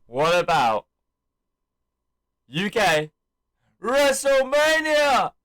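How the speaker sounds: teasing pauses building to a high, loud holler; showman's build to a shout; imitated big announcement. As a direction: announce it to the arena, last word drawn out. Acts out Cena teasing, then announcing.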